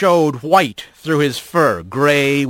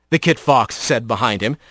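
plain reading voice

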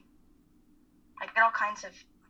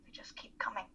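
soft whispered trailing off; worn-out whisper; exhausted whisper; 11 dB quieter than usual. Acted. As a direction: let it fade into a whisper. Confessing intrusive thoughts, exhausted.

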